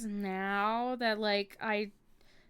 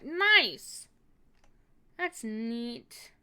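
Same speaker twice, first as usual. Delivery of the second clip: excited; 7 dB louder, much slower than usual. Real, not acted. Excited at seeing drawing.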